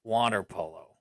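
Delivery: low, slow, creepy murmur; creepy man voice. Pretend. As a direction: drop into a creepy stranger's voice. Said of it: "why are you saying it like a creepy man right now".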